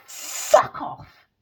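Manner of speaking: high, loud, strained mock outburst; retold angry brush-off. Telling him off for talking to me, retold angry outburst. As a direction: act out snapping at him to go away. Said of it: "And I'll be like".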